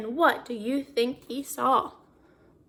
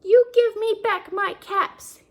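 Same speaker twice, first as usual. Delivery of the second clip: louder, higher demand; cross demand; 7 semitones higher, 5 dB louder than usual. Acted. Peddler demanding his caps from the monkeys, cross and loud. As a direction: order them to give the caps back.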